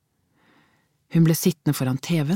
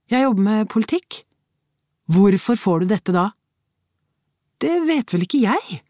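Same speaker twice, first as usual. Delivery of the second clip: quarrel; 6 semitones higher, 3 dB louder than usual. A narrator's reading. Spouses quarrel over letter.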